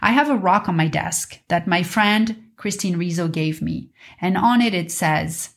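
ordinary talk

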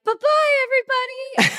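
high sing-song bye-bye; cheery farewell. Retold or imitated. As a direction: call it out brightly high. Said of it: "you were like".

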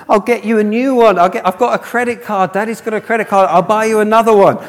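high, loud, hurried-sounding reassurance; frantic placating. Retold or imitated. Dad to crying daughter over broken doll, frantic placating. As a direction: act out a dad desperately offering a fix.

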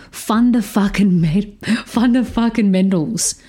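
funda fucking mentals sung out with a rising lilt; mock title announcement with a laugh; playful announcing. Shown as a demonstration. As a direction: pitch it like a class title you love. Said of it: "That would be my class if I had it".

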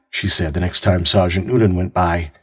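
ordinary delivery, nothing marked

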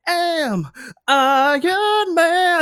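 high, slow, drawn-out hero line; Iron Man voice. Retold or imitated. Quoting the movie hero with a high, slow flourish. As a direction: ham up the big superhero declaration. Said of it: "It's like".

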